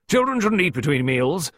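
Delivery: high, ringing pronouncement; ringing tones. A narrator's reading. Hearty visitor laying down the law, ringing tones. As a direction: declare it in a hearty, carrying voice. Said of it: "delivered in ringing, almost masculine tones".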